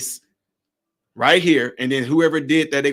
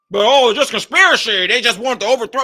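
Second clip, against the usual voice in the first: mock the doubters, raised and louder; dismissive put-on quote, pitched up; mocking skeptics; 5 semitones higher, 4 dB louder than usual. Mimicking those who dismiss it.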